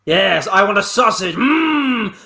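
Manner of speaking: ordinary talk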